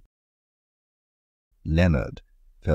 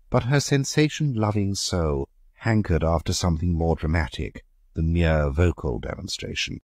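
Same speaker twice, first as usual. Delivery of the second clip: usual speaking voice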